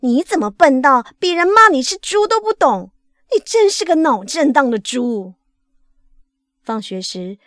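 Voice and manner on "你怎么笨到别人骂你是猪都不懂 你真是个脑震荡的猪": loud, high-pitched angry teacher; furious scolding. A narrator's reading. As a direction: blow up at the pupil, loud and exasperated. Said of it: "老师气得冒烟，骂道". (气得冒烟地大声责骂)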